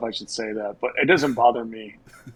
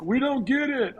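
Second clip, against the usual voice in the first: imitating heckler; 12 semitones higher than usual. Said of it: "this lady yelled out".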